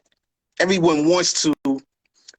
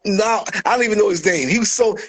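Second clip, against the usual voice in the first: normal talking voice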